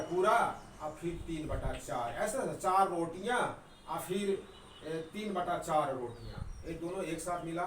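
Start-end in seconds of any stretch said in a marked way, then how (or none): none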